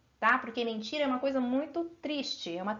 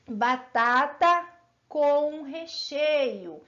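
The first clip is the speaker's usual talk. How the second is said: slow, drawn-out batata com recheio; slow demo; 2 semitones higher, much slower than usual. Shown as a demonstration. Teacher to pupils, slowly spelling out the phrase. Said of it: "se eu falar assim".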